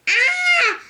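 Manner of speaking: short high yelp of fright, loud; whale's scream. Acted. Whale shrieks as it flees the shark, high frightened yelp.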